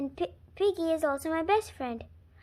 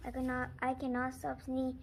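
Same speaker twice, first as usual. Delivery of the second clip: ordinary delivery, nothing marked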